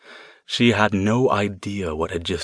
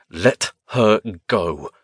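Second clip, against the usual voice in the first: growl; much slower than usual. A narrator's reading. Mage ordering the spirit to release her, slow growl. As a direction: hold the anger down and push it out slowly. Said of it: "he growled".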